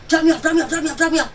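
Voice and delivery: loud, high, urgent repeated yell; retold frantic demand. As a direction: bark it over and over, desperate to leave. Ex demanding to be driven away.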